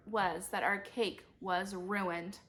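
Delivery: plain reading voice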